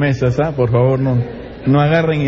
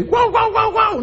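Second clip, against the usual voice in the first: playful high-pitched bark imitation; dog barking; slower than usual. Preacher imitating a dog greeting its master, high playful barking. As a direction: yap like a little pet dog. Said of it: "o un perrito ahí".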